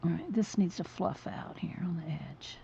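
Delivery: breathy whisper, breath held; holding breath. Real, not acted. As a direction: whisper through held breath while painting. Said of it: "I'm holding my breath".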